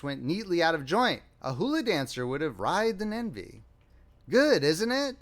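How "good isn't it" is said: much higher, eager question; demanding approval. A narrator's reading. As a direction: press keenly for agreement. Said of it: "demanded Perry".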